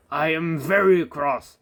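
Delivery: slow, loud stern scolding; very cross. A narrator's reading. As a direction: lay down the law slowly. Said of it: "said the fat controller".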